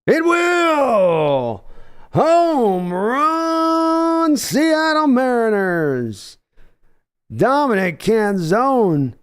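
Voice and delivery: rising, loud, stretched broadcaster call with a cry; excited home run call. Acted. Streamer calling the Mariners home run live, excited shout. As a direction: belt it out like the ball is leaving the park.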